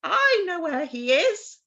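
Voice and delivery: high, loud crow voice, slow; talking crow. Acted. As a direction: announce it like a knowing crow. Crow answers Gerda.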